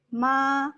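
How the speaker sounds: "ma" held level and a little high; first tone, flat. Shown as a demonstration. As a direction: keep "ma" very flat, no glide. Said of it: "it's very flat".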